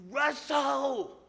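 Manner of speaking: high, loud call of a name; hammed-up boss hollering across the parking lot; yelling across the lot. Boss calling him from across the lot, yelling. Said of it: "I could hear him yelling".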